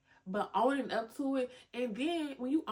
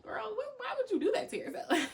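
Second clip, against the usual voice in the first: much higher, disbelieving friend voice; mock judging friend; 12 semitones higher than usual. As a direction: play the friend who judges her.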